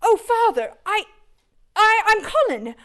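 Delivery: put-on high, halting boyish voice; a stammering boy meeting his father, voice pitched high; boy's voice. To his father, a boy stammers out his name in a high voice.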